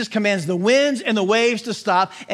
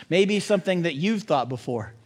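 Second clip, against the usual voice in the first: ordinary talk, nothing marked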